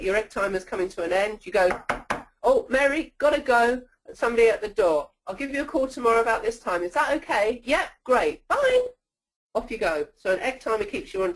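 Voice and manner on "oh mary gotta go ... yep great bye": higher-pitched, hurried sign-off to a client; acted phone excuse. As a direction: make a quick excuse and sing out a high "bye". Acts out ending a call quickly.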